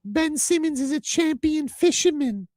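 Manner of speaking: put-on very high, slow old lady voice; a slow little granny reading the paper.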